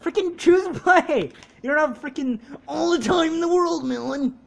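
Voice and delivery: loud, high, impatient scolding; real gamer exasperation; exasperated yelling. Real, not acted. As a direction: yell to hurry up a dawdling friend. Hurrying Melon to choose a play.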